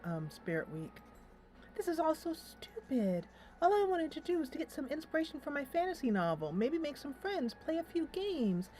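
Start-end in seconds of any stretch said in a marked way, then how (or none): 1.8-8.7 higher, fast fed-up rant; frustrated rant. Acted. Alicia ranting about her costume, frustrated and fast. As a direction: rattle off the complaints fast. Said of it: "She is so frustrated".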